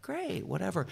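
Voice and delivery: high-pitched, offhand executive go-ahead; imitated breezy agreement. Executives' casual agreement, voiced.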